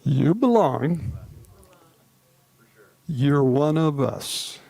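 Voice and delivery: slow and tender, each phrase given room. Shown as a demonstration. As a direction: say it gently, like a blessing. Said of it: "and say it again".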